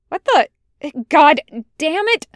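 high, loud exasperated curse; snarling back. A narrator's reading. Tucker snarls back at Washington, snarling. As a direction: explode right back at him. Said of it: "Tucker snarls right back".

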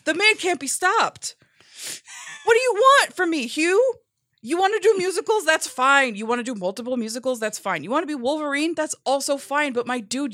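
loud, high, pleading exasperation; exasperated rant. Acted. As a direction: shout it at him, fed up but joking. Mock exasperated plea to Hugh.

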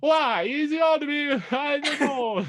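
very high, loud mock Italian wail; over-the-top comic Italian caricature; hammed-up Italian accent. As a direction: screech it slowly in a fake Italian accent. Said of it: "Kinda sound like [name] just there".

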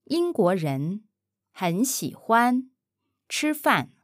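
英国人，很喜欢，吃饭 in slow separate chunks; chunked slow model. Shown as a demonstration. Mandarin teacher chunking the phrase slowly for the learner. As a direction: break 英国人很喜欢吃饭 into pieces for the learner. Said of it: "broken into chunks for learner".

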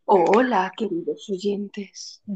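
slow, hushed ghostly greeting; voice from beyond the grave. Pretend. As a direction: speak like a ghost from the tomb, drawn out. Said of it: "Una voz de ultratumba".

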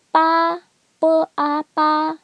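"ba" high and level; first-tone spelling drill. Shown as a demonstration. Teacher spelling out pinyin, "ba" high and level. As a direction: chant "b, a, ba" on a high, steady pitch. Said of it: "first tone".

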